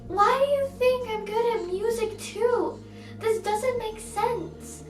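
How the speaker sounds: high, exasperated retold complaint; indignant protest. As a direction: protest in high-pitched mock outrage.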